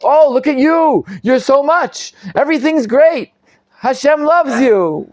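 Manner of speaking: high-pitched mock admiration; Hosea's sarcasm. Teacher as the prophet praising Israel, sarcastic. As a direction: gush insincerely, pitched well up. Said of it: "Hosea here isn't serious when he's saying".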